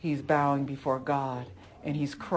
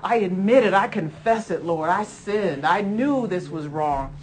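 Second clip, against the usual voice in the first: fervent prayer; 2 semitones higher, 5 dB louder than usual. Real, not acted. Preacher praying aloud to God, fervent confession. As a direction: pour out a heartfelt confession to the Lord.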